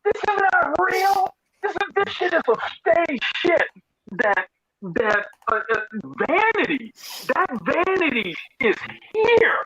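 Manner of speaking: very high, strained babble of disbelief; disbelieving rant. Retold or imitated. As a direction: spill it out like you're in shock. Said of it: "And I was like".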